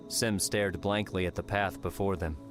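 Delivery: ordinary delivery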